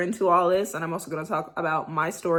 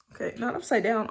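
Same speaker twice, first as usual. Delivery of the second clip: normal talking voice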